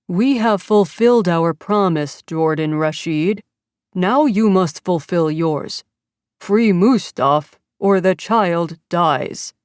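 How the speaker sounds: slow, menacing voice-changer threat; distorted kidnapper voice. Acted. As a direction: spell out the threat coldly and slowly. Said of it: "the mechanically distorted voice".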